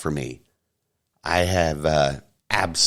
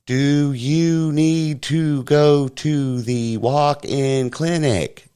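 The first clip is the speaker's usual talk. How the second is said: high, slow, over-careful voice like speaking to a foreigner; mock a woman talking down to a kid, drawn out; 7 semitones higher, much slower than usual. Woman at the scene talking down to the fallen kid, mocked slow and high. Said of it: "Why is she talking like this?".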